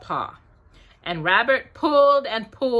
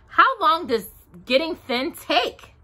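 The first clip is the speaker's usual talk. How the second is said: high-pitched anxious question; 8 semitones higher than usual. A narrator's reading. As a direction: ask it with mild worry, pitched up. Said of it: "asked Pooh anxiously".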